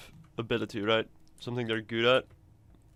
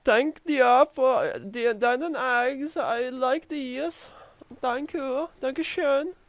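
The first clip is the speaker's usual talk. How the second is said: high, slow hammed-up German accent; 12 semitones higher, much slower than usual. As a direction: thank them in a goofy German accent. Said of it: "I'm suddenly a German guy".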